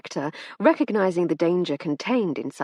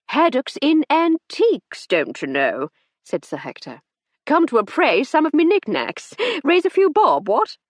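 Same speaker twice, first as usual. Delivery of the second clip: put-on loud, higher hearty old buffer; jovial old gent; 9 semitones higher, 6 dB louder than usual. A narrator's reading. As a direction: bluster it cheerfully. Sir Hector's hearty chatter.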